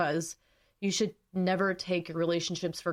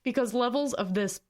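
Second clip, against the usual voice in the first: tearful laugh; 5 semitones higher than usual. Real, not acted. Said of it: "I'm trying not to cry".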